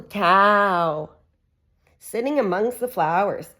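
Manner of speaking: lively sing-song picture-book reading; reading to children. Acted. Mum reading a farm book to her kids, animated. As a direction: read it out brightly for little ones.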